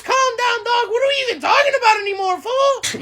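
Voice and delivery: ordinary talk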